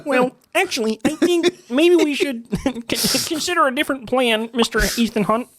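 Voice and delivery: high puppet-frog villain voice; Kermit the Frog. Pretend. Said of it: "I would prefer Kermit".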